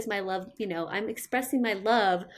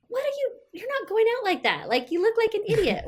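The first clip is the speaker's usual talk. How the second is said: high mock-scolding tease; playfully roast your kid's outfit; teasing mom; 12 semitones higher than usual. Mom teasing her kid about the outfit, high and mocking.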